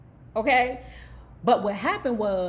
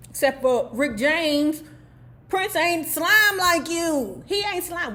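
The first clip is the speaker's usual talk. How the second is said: higher, louder and slow, insisting; indignant defense; 7 semitones higher, 5 dB louder, slower than usual. Acted. Defends [name] against [name].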